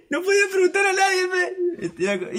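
high, loud laughing shout; exasperated shout. Retold or imitated. Teller ranting about the lost kid, exasperated high shout. Retold frustration at a lost foreigner.

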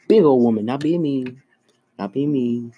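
ordinary talk

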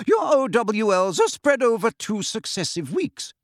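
brisk and formal, lay down the rules; stern teacher. Acted.

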